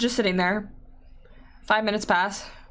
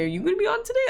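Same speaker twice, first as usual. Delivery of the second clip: mock eager squeal; 14 semitones higher, much faster than usual. Mimicking friend's eager message.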